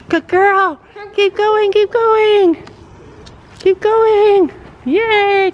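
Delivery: loud high cheering; excited cheering. Real, not acted. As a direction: cheer her on loudly. Cheering daughter's canter.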